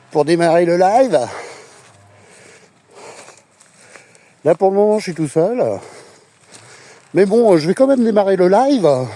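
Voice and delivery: loud, hyped livestream host; youtuber voice. Pretend. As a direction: play the eager streamer opening a live. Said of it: "je pourrais faire comme les youtubeurs".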